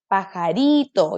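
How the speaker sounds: slow Pajarito, stress on ri; syllable by syllable. Shown as a demonstration. Teacher sounding out Pajarito, slowly. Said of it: "Digo pajarito".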